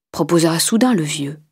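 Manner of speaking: ordinary delivery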